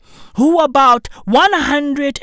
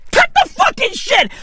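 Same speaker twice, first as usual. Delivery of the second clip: pitched way up, snapping at her; angry outburst; 13 semitones higher than usual. Real, not acted. Ranting at Marlene Engelhorn, angry and high. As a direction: cut her off hard.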